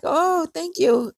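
high, drawn-out thanks; slow, lifted voice, playing flattered. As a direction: act out a sweetly flattered reply. Acted reply to a compliment.

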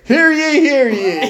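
loud, high proclamation call; town crier. Pretend. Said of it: "Here's the official declaration from Chance".

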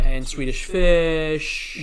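Swedish Fish drawn out very slowly in a fake French accent; mock French accent. Cohost adds candy in his jokey French accent. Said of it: "who has a French accent".

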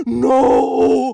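loud gasp into a wailed no; mom's shocked disbelief. Retold or imitated. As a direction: imitate mom gasping in shock. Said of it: "she got up and she goes".

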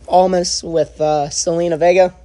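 ordinary talk

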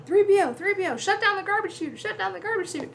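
high, urgent retold yell; mock yelling. Luke to C-3PO as the walls close, urgent yelling. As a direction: yell frantic orders over a comm, high-pitched. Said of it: "And he's yelling".

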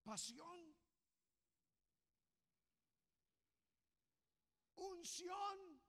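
high, loud, driving preacher's cry; fiery sermon climax; full-force preaching. Acted. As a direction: preach it out at the top of the voice.

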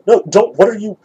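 ordinary talk

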